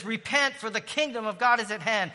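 fervent call to repent. Real, not acted. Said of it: "repeats Jesus' call, louder".

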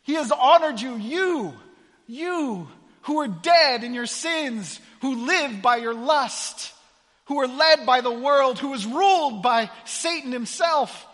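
preacher climbing to a ringing peak; preaching at full force. Acted. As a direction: drive it home to the congregation. Preaching builds to climax.

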